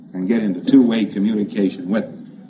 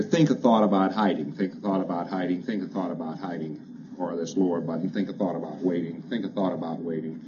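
rote repetition, fading; 4 semitones lower, 8 dB quieter than usual. Shown as a demonstration. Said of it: "ask him one after the other".

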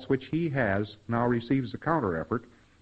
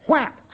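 slap sound; 7 dB louder than usual. Retold or imitated. A mock-up slaps him in the face.

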